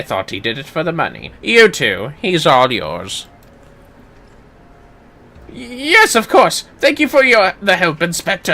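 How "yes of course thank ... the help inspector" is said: higher, louder eager thanks; grateful character. Acted. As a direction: thank the inspector brightly.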